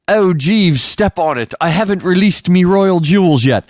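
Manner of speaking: high, hammed-up posh British accent; terrible British accent. Rich man to his chauffeur mid-chase, bad British accent. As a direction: put on a bad British master's voice. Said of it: "just the worst British accent I've ever done".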